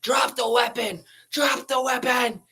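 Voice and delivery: high-pitched imitation of shouted commands; mock police scream. Retelling police yelling at kids with an airsoft gun, mock scream. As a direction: scream orders as an imitated cop, high and urgent. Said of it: "screaming at us".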